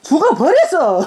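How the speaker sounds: blunt death notice in Jeolla accent; Jeolla dialect. Shown as a demonstration. Mother answers daughter's dialect quiz, Jeolla dialect. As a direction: say it in a Jeolla lilt. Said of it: "전라도는".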